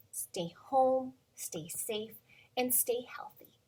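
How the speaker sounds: soft whisper. Real, not acted. Goodbye advice to kids.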